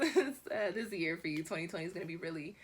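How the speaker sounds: usual speaking voice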